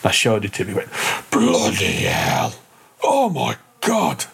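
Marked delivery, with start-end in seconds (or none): 1.3-4.2 amazed exclamation. Retold or imitated. Shopkeeper amazed by carving.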